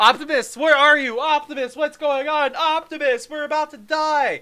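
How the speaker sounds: loud, high panicked calls; panicked calling. Retold or imitated. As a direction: yell for help in mock panic.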